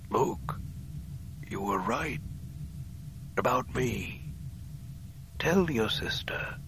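soft, slow dying whisper; whispering into his ear. Acted. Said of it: "spoke into his ear".

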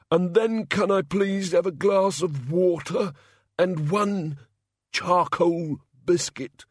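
high, slow, queasy god; a sickly hungover god, lightly voiced. A narrator's reading. Hungover god's voice.

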